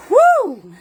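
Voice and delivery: high, loud whoop with a laugh; joyful woo to the chat; excited cheer. Real, not acted. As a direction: let out a happy whoop. Cheering at the viewer comment.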